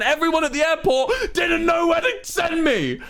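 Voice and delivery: high, incredulous outburst; exasperated rant. Real, not acted. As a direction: vent at the absurdity, voice climbing. Ranting about nobody helping.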